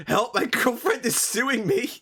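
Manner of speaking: high, slow mock cry for help; hammed-up plea of a man in trouble; mock distress. Streamer reading next thread title, mock distress.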